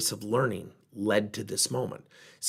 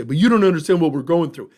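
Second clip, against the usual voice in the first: complaining employee; 3 semitones higher, 7 dB louder than usual. Pretend. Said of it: "one might ... say".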